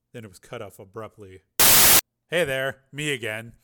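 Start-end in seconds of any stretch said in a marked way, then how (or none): none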